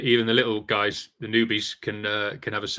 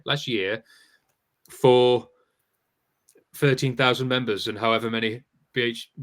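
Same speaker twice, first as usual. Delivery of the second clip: usual speaking voice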